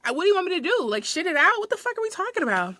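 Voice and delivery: high, incredulous retort; acted exasperation. Store worker retelling a fight with customer, acted exasperation. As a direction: pitch it up in retold disbelief.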